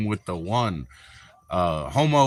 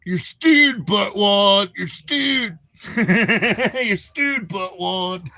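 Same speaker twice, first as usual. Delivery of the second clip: mock shout; 9 semitones higher, slower than usual. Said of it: "I said".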